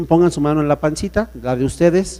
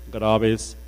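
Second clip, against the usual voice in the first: much lower pitch; 7 semitones lower than usual. Shown as a demonstration. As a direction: drop the word graves deep. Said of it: "tesitura, graves". (grave, con voz baja)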